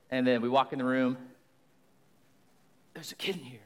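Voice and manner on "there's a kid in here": put-on breathy whisper so the kids sleep on. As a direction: hush it like you're beside a crib. Cop whispering in kids' room.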